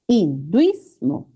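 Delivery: "Hinduismo" slowly in chunks, hin-DUIS-mo; teacher sounding out "Hinduismo" slowly; syllable-by-syllable demo. Shown as a demonstration. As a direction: stretch "Hinduismo", keeping duis as one stressed syllable. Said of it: "la sílaba tónica es hinduismo".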